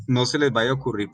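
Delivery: usual speaking voice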